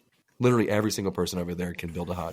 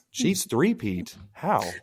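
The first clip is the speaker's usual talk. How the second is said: mock puzzled fan; 5 semitones higher, much slower than usual. Mocking puzzled foreign fans.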